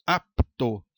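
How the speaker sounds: "Apto" said as "Ap... to", falling away; split into syllables. Shown as a demonstration. Teacher repeating the example for students, "Apto" split by syllable. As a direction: separate P from T in "Apto". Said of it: "em sílabas diferentes".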